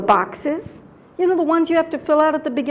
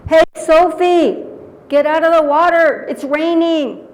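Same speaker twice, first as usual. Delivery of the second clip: loud call across the water, higher; 4 semitones higher, 7 dB louder than usual. Retold or imitated. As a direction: shout to her to get out of the lake. Family calling out at lake.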